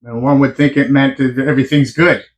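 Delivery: usual speaking voice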